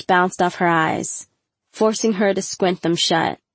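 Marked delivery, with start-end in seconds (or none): none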